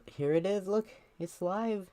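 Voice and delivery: high, slow excited announcing; thrilled reveal, pitch way up. Real, not acted.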